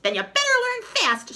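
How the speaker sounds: very high, loud shriek; furious witch shrieking. Acted. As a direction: shriek the threat at him. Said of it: "shrieked the witch".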